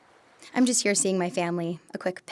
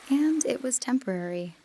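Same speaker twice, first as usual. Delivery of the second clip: ordinary talk, nothing marked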